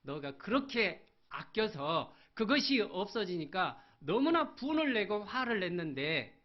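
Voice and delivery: high, loud preaching; fiery preaching. Acted. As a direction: drive it home to the congregation. Preaching at full force. (힘차게 외치는 설교)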